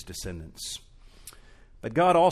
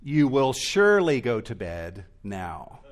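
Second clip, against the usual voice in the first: loud NO, then a stern ruling; firm parent; 6 dB louder, slower than usual. Pretend. Parent refusing a child's bedtime bargain, loud and firm. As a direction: lay down the law at bedtime. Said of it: "That means".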